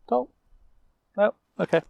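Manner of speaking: ordinary delivery, nothing marked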